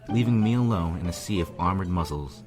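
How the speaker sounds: usual speaking voice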